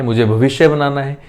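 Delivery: ordinary delivery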